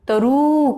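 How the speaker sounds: तरु with a long held oo. Shown as a demonstration. Teacher shows students' mistake, तरु with long oo. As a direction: drag out the last vowel of तरु. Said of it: "छोटा ऊ है इसमें".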